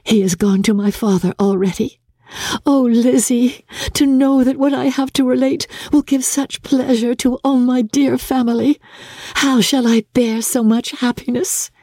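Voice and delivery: put-on a young woman bursting with news; Jane's eager voice. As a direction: rush to tell her mother. Jane speaks to Elizabeth.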